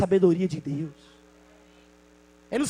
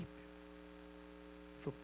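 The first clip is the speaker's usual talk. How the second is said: normal talking voice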